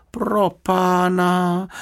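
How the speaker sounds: high, long-drawn cry of dismay; exclaiming. A narrator's reading. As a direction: cry out slowly, voice pitched right up. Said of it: "vykřikl Jonatán". (zděšené, protáhlé zvolání)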